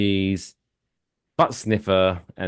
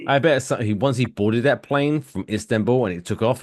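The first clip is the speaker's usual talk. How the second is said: ordinary talk, nothing marked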